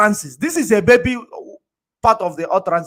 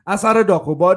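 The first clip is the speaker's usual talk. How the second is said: usual speaking voice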